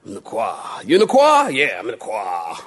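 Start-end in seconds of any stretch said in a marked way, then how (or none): 0.8-1.5 a much higher put-on voice asking; high mock questioner. Acted exchange about the choir. 1.5-2.6 a much lower, softer put-on answer; low mock reply. Acted exchange about the choir.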